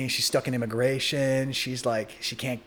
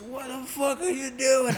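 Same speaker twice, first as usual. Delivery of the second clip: shouting; 13 semitones higher, slower than usual. Retold or imitated. Snatching weed from security.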